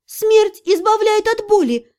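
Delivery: high, drawn-out retort; slow, emphatic counter-argument. A narrator's reading. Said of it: "парировала я".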